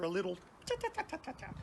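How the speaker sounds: twitter nervously like an owl. Retold or imitated. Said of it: "a little nervous twitter".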